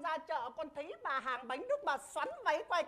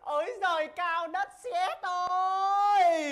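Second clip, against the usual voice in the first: mock wail; 5 semitones higher, 7 dB louder, much slower than usual. Clown wails comically to heaven.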